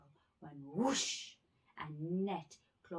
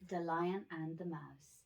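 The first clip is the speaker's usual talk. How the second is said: ordinary delivery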